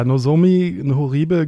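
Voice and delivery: usual speaking voice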